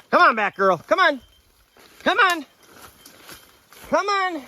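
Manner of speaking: high, loud call to the dog; calling from far away. Real, not acted. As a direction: call her back across the woods.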